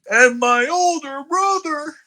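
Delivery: high, slow mocking voice; sing-song mockery of a promo. Co-host mocking Gunther's promo in a high voice.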